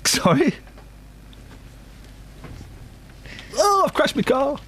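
very high-pitched mock celebrity impression. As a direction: plead in a silly high voice.

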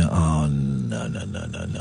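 soft low sinking "oh no"; a dropping, hushed rising panic; muttered despair, almost under the breath; quiet dread. Retold or imitated. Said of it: "I'm thinking".

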